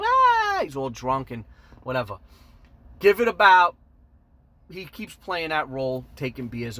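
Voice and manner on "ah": put-on high loud drunken cry; drunken yelp. Rhoda hugging the guy, high drunken yelp. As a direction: let out a sloppy drunk yelp.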